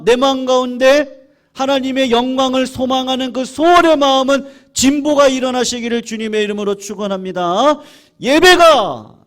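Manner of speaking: loud, rising preaching; fervent benediction. Acted. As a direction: pronounce the blessing with force. Closing blessing prayer.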